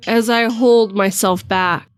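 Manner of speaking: plain reading voice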